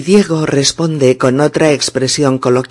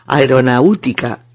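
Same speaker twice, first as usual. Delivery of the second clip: 'aeronáutica' said as a-e-ro-na-Ú-ti-ca, after a chuckle; wrong stress on U. Shown as a demonstration. Teacher repeats the incorrect form, stress wrongly on U. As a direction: split the 'au' of 'aeronáutica' and stress U. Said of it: "y eso es incorrecto".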